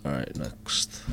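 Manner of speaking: whispering. Real, not acted. Whispers after the verdict.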